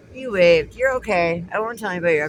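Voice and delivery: loud friendly call across the road. Real, not acted. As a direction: shout it over to the cop. Calling out to the passing cop.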